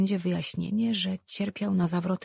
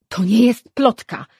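very loud, higher protest; exclaiming; 5 semitones higher, 13 dB louder than usual. A narrator's reading. Mother insists to her daughter the rumour is true, exclaiming. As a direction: burst out in loud contradiction. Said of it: "wykrzyknęła matka".

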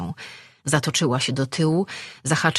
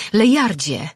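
loud, higher cry of his name; shouted call; 6 semitones higher, 7 dB louder than usual. Acted. Woman calling after a man leaving the cave, shouting. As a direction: call out urgently to stop him. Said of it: "krzyknęła".

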